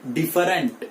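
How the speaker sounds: 'डिफरेंट' in three syllables, di-fa-rent; wrong pronunciation. Shown as a demonstration. Teacher showing students the common mistake, wrong pronunciation. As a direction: say 'डिफरेंट' with the middle vowel sounded. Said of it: "but that is wrong pronunciation".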